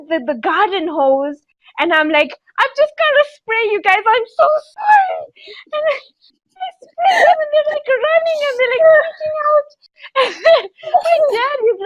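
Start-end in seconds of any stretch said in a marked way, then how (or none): 2.6-5.3 very high, squeaky pleading; panicked apology. Retold or imitated. Owner apologising to cats before hosing them, mock panic. 5.7-11.8 high, squeaky telling on the edge of laughter; laughing while telling. Real, not acted. Storyteller recalling cats fleeing the hose, squealing with amusement. Breaking up retelling hosing the cats.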